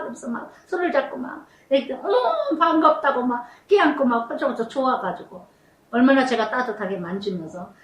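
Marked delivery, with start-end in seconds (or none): 2.0-3.2 어머 jumps up high in glad surprise; happy shriek of recognition; delighted greeting. Retold or imitated. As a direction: greet an old friend with a bright surprised cry. Joyful greeting retold.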